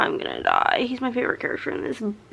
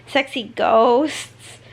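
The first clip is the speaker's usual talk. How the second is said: slow drawn-out tease; much slower than usual. Retold or imitated. Heroine teasing him about her type, drawn out slowly. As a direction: stretch out sexy ghosts slowly, playfully. Playful drawn-out character quote.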